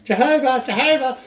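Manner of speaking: high, loud "Jehovah, Jehovah!" cry; mock outcry. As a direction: shriek it like a man in a comedy film. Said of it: "guys getting stoned to death for going".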